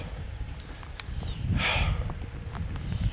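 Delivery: long unvoiced sigh of frustration; weary sigh. Real, not acted. Vlogger fed up with town poachers, sighing. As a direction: let the breath out heavily. After swearing on camera.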